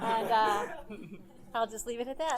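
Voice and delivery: chuckling through the words; laughing. Real, not acted. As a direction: laugh while you say it. Joking about meeting in a bar.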